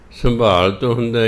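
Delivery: normal talking voice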